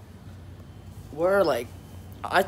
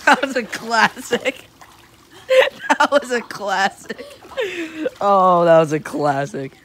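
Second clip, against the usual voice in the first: amused; 3 semitones higher, 8 dB louder, slower than usual. Real, not acted. Savouring a funny moment.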